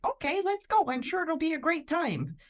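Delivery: put-on a keen twenty-year-old going along with it; people-pleasing eagerness. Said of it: "a people pleaser was like".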